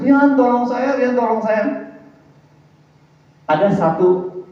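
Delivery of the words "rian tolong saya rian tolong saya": high, louder pleading call to Rian; mock cry for help. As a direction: act out a drowning man calling his friend. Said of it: "Terus saya bilang".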